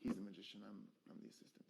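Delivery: low, barely audible confession; reluctant admission. Shown as a demonstration. Magician admits he is only the assistant, reluctantly. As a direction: let it fade away grudgingly. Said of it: "almost like I don't wanna say it".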